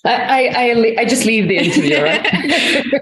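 quick, joking words through laughter; laughing. Real, not acted. Guest joking about quitting the interview, laughing. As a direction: joke it out while laughing, ending high.

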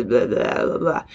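low, mumbled American drawl. Retold or imitated. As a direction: slur it into a lazy American drawl. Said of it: "a very kind of drawl".

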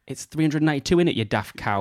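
put-on light Yorkshire accent, scolding herself; muttering to herself in Yorkshire. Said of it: "That was in a Yorkshire accent".

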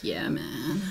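low, very soft mumbled agreement; stoned cult follower. Acted. Cultist sitting around agreeing with the leader, low and hushed. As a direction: drop low and quiet like a hanger-on.

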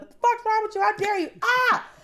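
high, fast, loud mock outrage; outraged crowd. Comic acting outraged people at the first baby named Brian, shrieking. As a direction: scream at the parent like a scandalized mob. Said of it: "people were like".

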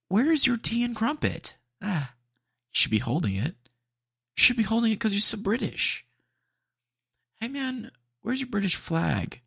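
high, hammed-up fake British voice; mock British accent. Ribbing a customer for being so British, mock British accent. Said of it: "his accent sounded like an American doing a British accent".

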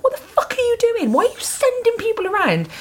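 very high retold wail of protest; tearful protest. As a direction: squeal it, mock-distraught. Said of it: "back off stage crying".

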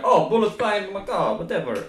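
put-on a failed French accent that slides into Jamaican; offhand Jamaican-accented shrug; accidental Jamaican accent. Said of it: "that's a Jamaican accent".

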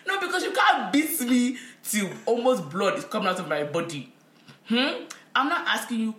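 hammed-up aggrieved protest; mock confrontation. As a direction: tell mother off, loudly. Acted scolding of the mother.

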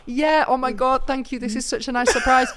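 hammed-up gushing boyfriend; playing along. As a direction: fake gratitude for the hotel manager. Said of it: "he starts to play along".

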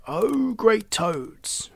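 high, drawn-out ceremonial hail; grand address. A narrator's reading. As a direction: hail them like a herald, very slowly. Herald addresses the toads.

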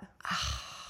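long low weary sigh; low heavy sigh. Retold or imitated. Mum sighing about facing the teacher. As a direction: sigh out the dread. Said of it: "and I'm like".

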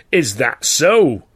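loud and very slow; sneering challenge. Acted. Manager sneering at the older man, loud. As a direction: drag it out, daring him.

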